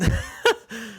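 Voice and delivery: short, high-pitched laugh. Real, not acted. Podcast host setting his apple core story aside, laughing.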